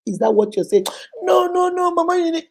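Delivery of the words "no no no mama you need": higher, pleading protest; protesting client. Retold or imitated. Client pushing back at Mama Unique, higher pleading voice. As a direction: imitate the client's high objection.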